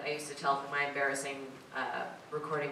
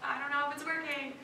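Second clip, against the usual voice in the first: lightly voiced nervous self-talk, higher; flustered inner voice; 9 semitones higher than usual. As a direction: play your own panicky thoughts mid-take. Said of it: "that's just legit me being like".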